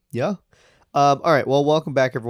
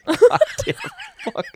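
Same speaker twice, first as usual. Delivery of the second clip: laughing curse; 4 dB louder than usual. Real, not acted. Flustered, laughing mid-sentence.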